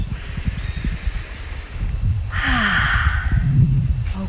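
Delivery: airy unvoiced exhale sigh; just breathe it out, no voice; breathy sigh. Shown as a demonstration. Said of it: "take a breath".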